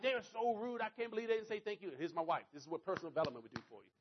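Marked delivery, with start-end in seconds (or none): 0.0-1.9 high, indignant retold griping; complaining. Husband to wife about the rude family, complaining. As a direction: keep moaning to your wife, pitched up. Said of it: "I'm complaining to Tracy".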